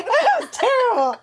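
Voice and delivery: laughing through the words; giggling, high and amused. Real, not acted. Laughing at her failed accent.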